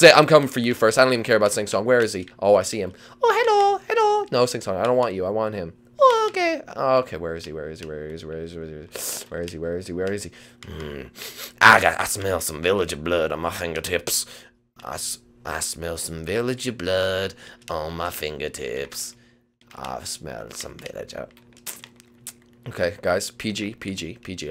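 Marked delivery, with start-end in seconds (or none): 3.2-4.3 squeaky, very high hello; high-pitched game character. Acted. Character greeting the player, squeaky and high. 6.0-6.6 tiny squeaky reply, very high; high-pitched game character. Acted. Character told he isn't wanted, squeaky. 11.6-21.3 low, slow prowling creature voice; villager-hunting monster. Acted. Monster seeking villagers to catch, low and menacing. Hunter figure sniffing for villagers.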